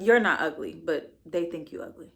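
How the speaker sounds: ordinary talk, nothing marked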